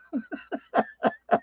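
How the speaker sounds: high breathy laugh. Real, not acted. Laughs after mom imitation.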